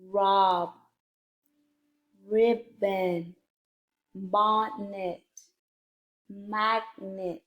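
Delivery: careful, drawn-out word list; slow word reading. Shown as a demonstration. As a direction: read each word slowly, syllable by syllable. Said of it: "a little bit faster".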